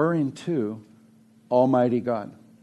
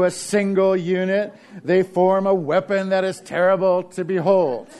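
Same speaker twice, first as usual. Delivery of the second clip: menacing Lucy; 6 semitones higher, 3 dB louder than usual. Retold or imitated. Lucy threatens Linus with fist.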